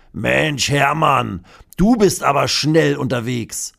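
leicht gespielter Postbote, etwas höher und lauter; gut gelaunter Postbote. Acted. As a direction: ein Hauch von fröhlichem Postboten. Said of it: "sagt der Postbote und lacht". (leicht gespielter, heiterer Postbote)

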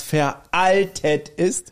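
very slow, drawn-out mimicry; mock scolding quote. Podcaster echoes the man's verdict on brown shoes, drawn-out mock scolding. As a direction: stretch VERALTET out long, imitating the scolder. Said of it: "schrie den anderen an".

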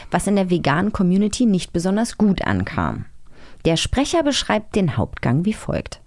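ordinary delivery

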